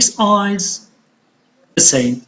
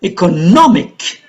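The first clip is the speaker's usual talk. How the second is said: "Economic" with "nom" stressed and raised; stress on third syllable. Shown as a demonstration. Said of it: "on the third".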